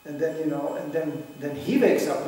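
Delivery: ordinary talk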